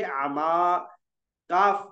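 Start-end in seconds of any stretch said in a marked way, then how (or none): 0.0-0.8 आमा with a light, long "aa"; thin alif. Shown as a demonstration. Teacher answering for the class, आमा thin and drawn out. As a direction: hold आमा open, lips unrounded. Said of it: "इसको क्या पढ़ेंगे".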